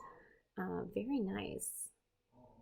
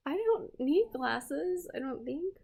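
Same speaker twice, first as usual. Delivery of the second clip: mock-worried; 6 semitones higher than usual. Real, not acted. Joking about failing eyes.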